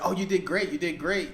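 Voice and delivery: high, loud, over-the-top gushing; mock flattery laid on thick; gushing praise. Mock praise from yes-men friends.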